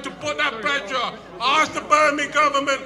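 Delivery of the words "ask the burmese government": shouting protest speech, rising in force; rally speech. Real, not acted. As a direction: rouse the crowd, louder and louder.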